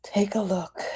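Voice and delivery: soft unvoiced whisper. Real, not acted. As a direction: breathe it as a gentle invitation. Closing hushed invitation.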